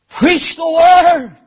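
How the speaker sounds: high pulpit cry, opening in a long shout; full-force preaching. Real, not acted. Preacher calling for new godly preachers, full-force preaching. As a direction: launch it at the congregation as a rallying call.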